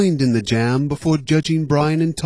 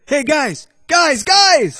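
calling out; 12 semitones higher, 4 dB louder, slower than usual. Acted. Brian calling out, high.

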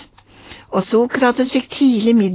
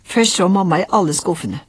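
ordinary delivery

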